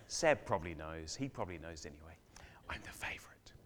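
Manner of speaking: playful near-whispered boast, very soft; confiding aside. Pretend. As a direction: drop to a whisper, like a cheeky secret. Joking aside about grandfather rivalry.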